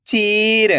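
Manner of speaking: चीर joined as one word with long ई; blended word. Shown as a demonstration. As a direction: read चीर whole, stretching the ची. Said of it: "खींच के पढ़ना है".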